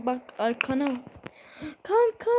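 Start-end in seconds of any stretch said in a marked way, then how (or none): 1.8-2.3 high-pitched exclamation to a buddy; pretend astonished cry. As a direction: call out in make-believe shock, high. Finding friend thought dead. (oyun icabı şaşkın, tiz sesleniş)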